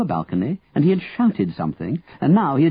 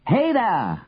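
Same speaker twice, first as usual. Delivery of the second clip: high-pitched call to someone below; raised, carrying shout at normal volume; 7 semitones higher than usual. A narrator's reading. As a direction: call out as if through a cupped hand. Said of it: "Henry shouted, cupping a hand".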